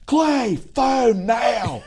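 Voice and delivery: high, drawn-out Southern holler; hammed-up high-pitched summons; calling out. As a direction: yell for the husband across the yard. Lady calls out to her husband.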